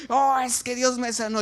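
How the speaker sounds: high-pitched retold exclamation; shouted testimony. Third person coming in to announce a healing, shouting. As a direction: cry out the news of a healing. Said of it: "viene gritando".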